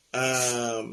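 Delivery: usual speaking voice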